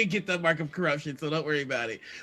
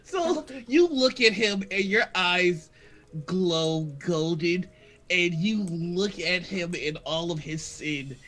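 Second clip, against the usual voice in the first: game master narration; 3 semitones lower, 4 dB quieter than usual. A narrator's reading. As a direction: narrate a holy moment with weight, softening gradually.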